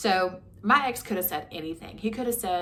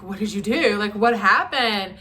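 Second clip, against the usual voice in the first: louder, slow demanding questions; retold alarmed question; 3 semitones higher, 9 dB louder than usual. Asking her boyfriend loudly what he had done, alarmed. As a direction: press him hard on what he did. Retelling her own worried reply.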